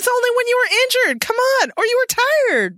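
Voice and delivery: high, whiny mock protest. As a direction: whine it up high like Diana. Mocking Diana's protest in quote.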